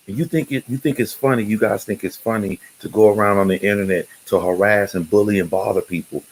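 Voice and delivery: mock his own angry community post; retold heated rant. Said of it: "where I'm, like, really feeling it".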